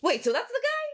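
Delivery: confused surprise. Retold or imitated. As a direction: ask in sudden confusion. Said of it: "And then you're like".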